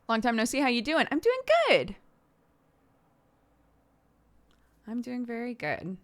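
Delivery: high, bright greeting; high chirpy greeting; cheery greeting. Real, not acted. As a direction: greet them loudly and happily. Greeting someone on stream.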